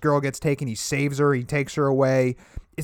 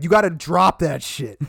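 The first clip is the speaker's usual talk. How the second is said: mock stern scolding; 5 semitones higher, 6 dB louder than usual. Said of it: "He's like".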